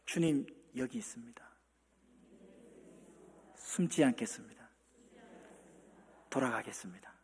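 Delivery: the congregation's quiet prayer of return; whispered confession. Shown as a demonstration. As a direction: confess humbly under your breath. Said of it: "이렇게 우리 한번 고백해 볼까요".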